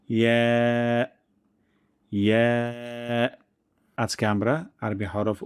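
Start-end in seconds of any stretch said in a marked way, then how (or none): 0.1-3.3 long, smooth ইয়া as "ye"; soft ya. Shown as a demonstration. Teacher modelling for practice, soft long "ye" ইয়া. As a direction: hold ইয়া on a light ae. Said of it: "soft বা নরম করে".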